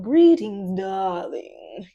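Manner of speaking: big theatrical tarot reader hello; tarot reader greeting. Retold or imitated. Quoting a tarot YouTuber's grand opening greeting. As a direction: greet like a dramatic tarot host. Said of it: "she's always starts off".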